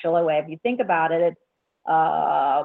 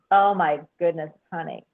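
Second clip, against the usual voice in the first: normal talking voice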